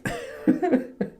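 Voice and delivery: airy giggle; short breathy chuckle; breathy high laugh. Real, not acted. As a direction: laugh at yourself. Laughs at sad story.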